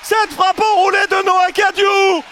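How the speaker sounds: excited sports commentary. Real, not acted. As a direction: yell the stunning strike. Winning goal call.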